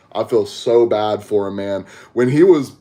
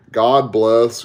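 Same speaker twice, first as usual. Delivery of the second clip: ordinary talk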